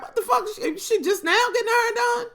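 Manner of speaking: mock husband outburst, high and fast; husband's disbelief. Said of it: "My husband's like".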